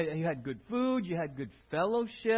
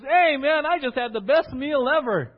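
louder, eager rave about a meal; enthusiastic recommendation; 4 semitones higher, 8 dB louder than usual. Pretend. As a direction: sell your friend on the new salad.

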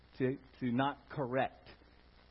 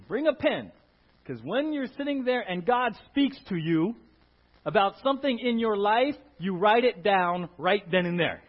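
loud, high preaching; preached quote; 4 semitones higher, 10 dB louder than usual. Retold or imitated. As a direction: proclaim it to the congregation.

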